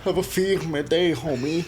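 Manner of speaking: high, slow mock greeting. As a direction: drawl it out in a put-on voice. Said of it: "I could just imagine that".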